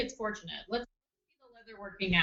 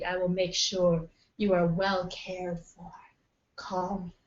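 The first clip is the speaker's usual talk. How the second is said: low, level come-hither telehealth pitch; seductive hotline voice; 3 semitones lower than usual. Acted. Nurse pitching a paid telehealth line to lonely callers, seductive. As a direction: coax callers in with a sultry, lowered voice. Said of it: "but the voice is unnecessary".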